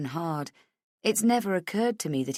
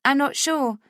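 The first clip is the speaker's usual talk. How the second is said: higher, fast receptionist voice; brisk receptionist; 10 semitones higher, 5 dB louder than usual. A narrator's reading. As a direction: rattle it off like a busy receptionist. Receptionist answers.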